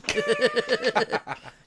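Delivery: high burst of laughter; wheezy laugh. Real, not acted. Joking about the man who games all day, laughing hard. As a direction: crack up at your own jab. Laughing at own jab at housemate.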